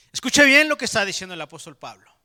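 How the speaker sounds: "Escuche bien" dicho mucho más fuerte, casi gritando; llamado fuerte. Acted. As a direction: levanta la voz para que todos atiendan. Predicador exige atención. (el predicador exige a la congregación que escuche, gritando)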